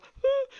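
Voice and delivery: very high, loud yelp of panic; a sudden high squeal as the crowd hits; panicked yelp. Retold or imitated. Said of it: "you just see the new girls go".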